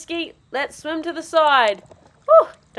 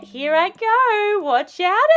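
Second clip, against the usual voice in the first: normal talking voice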